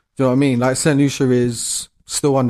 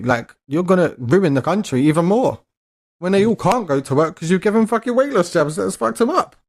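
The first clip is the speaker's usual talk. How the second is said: rising outrage; 6 semitones higher than usual. Real, not acted. Ranting to her co-host about the jabs, climbing to high outrage. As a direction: pitch climbing higher with each line of the rant.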